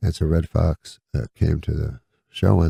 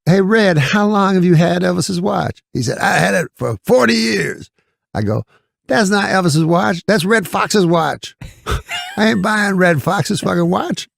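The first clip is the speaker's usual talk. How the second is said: Eddie Murphy doing voices; 12 semitones higher than usual. Retold or imitated. Retelling Eddie Murphy mocking Red Fox, high and scoffing. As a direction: pitch way up and scoff at the con. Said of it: "he's doing voices".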